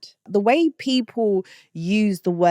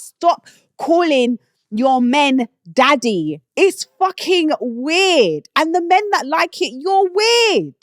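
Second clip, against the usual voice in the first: high, slow, emphatic telling-off; exasperated rant; 9 semitones higher, 5 dB louder, slower than usual. Real, not acted. Scolding British people for saying daddy, exasperated outburst. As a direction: raise the pitch and hammer each word in exasperation. Ranting at listeners about the word.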